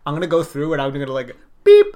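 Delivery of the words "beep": imitated censor beep. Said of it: "I'm gonna add a beep right here".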